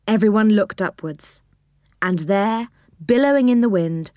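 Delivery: ordinary delivery, nothing marked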